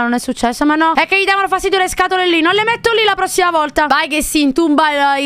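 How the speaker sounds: normal talking voice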